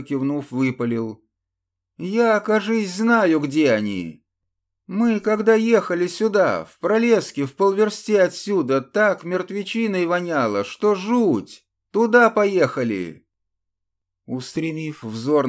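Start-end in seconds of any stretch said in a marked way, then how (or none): 2.0-13.3 higher, louder excited blurting; eager younger brother. A narrator's reading. Vasily blurts out, voiced higher.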